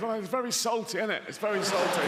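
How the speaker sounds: high put-on goldfish voice; complaining goldfish. Goldfish finding itself in the sea, high-voiced complaint.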